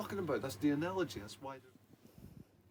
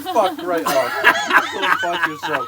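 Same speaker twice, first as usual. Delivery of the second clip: laughing swearing; 10 semitones higher, 17 dB louder, much slower than usual. Real, not acted. Laughing mock outrage at Rodney.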